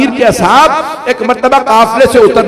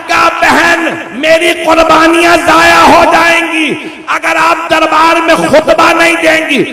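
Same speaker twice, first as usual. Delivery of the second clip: mournful majlis recitation; 6 semitones higher than usual. Acted. Preacher to mourners recounting Karbala, impassioned lament. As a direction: wail the tragedy out to the gathering.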